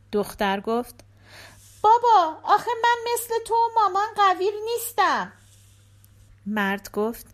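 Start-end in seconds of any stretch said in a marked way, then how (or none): 1.8-5.3 put-on high, eager child's voice; little girl voice. A narrator's reading. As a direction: play the small daughter at the door. Daughter voiced.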